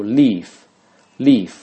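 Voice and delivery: leaf said slowly and clearly, twice; drawn-out "leaf" for the class; teacher modelling "leaf"; model pronunciation. Shown as a demonstration. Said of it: "读".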